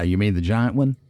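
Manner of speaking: ordinary talk, nothing marked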